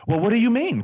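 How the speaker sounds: high, over-proper professional voice; insurance-guy voice. Shown as a demonstration. Agent answering mentor before clients, high put-on voice. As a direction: stay in polite salesman character. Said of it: "I'm still insurance guy".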